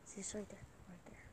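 hushed, very soft whisper. Real, not acted. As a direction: drop to a near whisper. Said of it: "I shouldn't yell".

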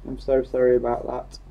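ordinary talk

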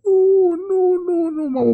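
very high, thin moan of denial; despairing wail. Acted. Shopper finding the card empty, wailing in despair. Realises the money is gone.

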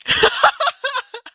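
high-pitched burst of laughter; real laughter. Real, not acted. Laughs at the hockey clip.

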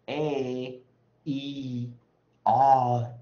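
A E I O held long and loud, one by one; long vowels demonstrated. Shown as a demonstration. Teacher modelling long vowels for students, loudly sustained. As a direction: draw out each of A E I O at full volume. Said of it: "我们讲的长母长元的时候啊".

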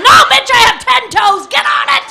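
loud, scornful snap back; loud comeback. Acted. Customer yelling back at the nosy nail tech. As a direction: shut her down loudly.

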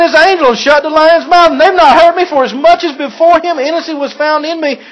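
Daniel calling up carefree from the den; a light, upbeat voice telling the king all is well; cheerful Daniel. Acted.

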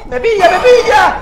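high, loud panicked shout; being chased. Real, not acted. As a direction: yell as it grabs you. Monster catching the player.

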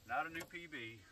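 ordinary talk, nothing marked

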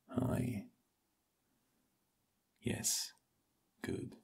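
soft whisper right in the ear. Acted. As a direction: breathe the words close to the ear. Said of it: "I'm going to whisper in your ears".

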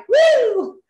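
high, energetic "woo"; high excited whoop. Shown as a demonstration. Consultant showing her team her natural energy at night, excited whoop. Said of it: "I'm still like".

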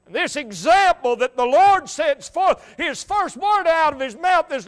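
full-force preaching. Real, not acted. Preacher points to the thief's example, full-force preaching. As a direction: build toward the cry.

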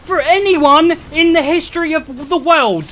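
high, loud, stuttering disbelief; flabbergasted yelling. Real, not acted. Speedrunner who has never seen that happen, flabbergasted yell. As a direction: keep repeating it, rising to a frantic shriek. Speechless at freak bad luck.

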